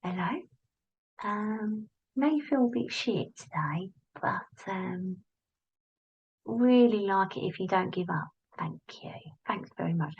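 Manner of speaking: very soft, hesitant little worm voice; little friendly voice. Acted. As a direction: plead politely and quietly, like a tiny worm. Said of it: "a little voice going".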